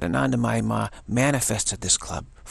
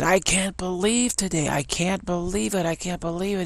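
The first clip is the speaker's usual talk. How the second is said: high-pitched, worked-up mock yell; disbelieving stranger pitched high, half-yelled; retold yelling; 9 semitones higher than usual. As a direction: imitate the exasperated truck guy, pitch way up. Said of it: "He's yelling at me".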